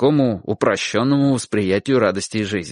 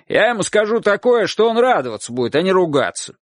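raised, persuading call; shouting; 8 semitones higher than usual. A narrator's reading. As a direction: promise it in a high call across the yard. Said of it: "крикнул мужчина". (убеждающий крик)

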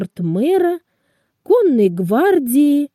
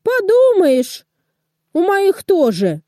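higher-pitched, dismissive retort; acted one-upmanship in a raised pitch; not backing down; 8 semitones higher than usual. A narrator's reading. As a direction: toss it back, unimpressed, pitch up. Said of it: "не уступал Кингс-Кросс".

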